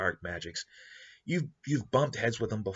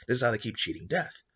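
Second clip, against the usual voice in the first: ordinary talk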